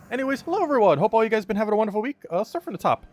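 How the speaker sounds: loud, high, cheerful shout-outs to chat; bright livestream roll call; streamer greeting. Real, not acted. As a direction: greet each viewer by name with energy.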